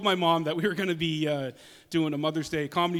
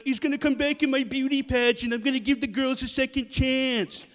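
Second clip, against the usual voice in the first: higher, imitated Trump voice; Rosie's Trump; 7 semitones higher than usual. Comedian retells Rosie's Trump impression on The View, mimicking. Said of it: "Rosie did an awesome impression of Trump".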